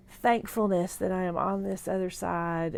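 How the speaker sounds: ordinary talk, nothing marked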